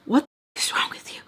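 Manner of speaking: unvoiced, seething whisper; hushed outrage. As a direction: whisper the angry question. Retold confrontation with mother.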